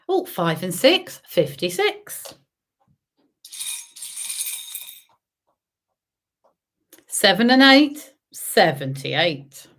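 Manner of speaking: sing-song number calling; bingo caller. Acted. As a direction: call each number out, drawn out. Calls bingo numbers to players.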